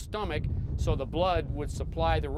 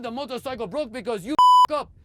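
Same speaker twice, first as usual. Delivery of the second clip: put-on louder, furious shout at the mechanics in a French accent; angry French-accented outburst; 10 semitones higher, 13 dB louder than usual.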